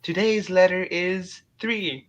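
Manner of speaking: high, slow puppet announcement; Big Bird. Acted. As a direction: present the day's letter as a big puppet. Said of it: "Big Bird".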